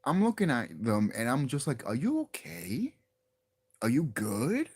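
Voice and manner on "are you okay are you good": slow, questioning mock concern. YouTuber asking freaked-out podcasters if they're alright, mock concern. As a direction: check on them as if they've lost it.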